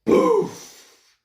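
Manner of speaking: very high, loud boof blast noise; big anime blast effect; explosion sound. Acted.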